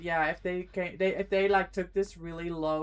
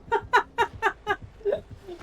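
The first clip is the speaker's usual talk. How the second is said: big real burst of laughter; 12 semitones higher than usual. Real, not acted. Bursts out laughing at own story.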